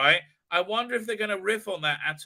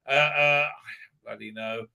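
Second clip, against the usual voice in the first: normal talking voice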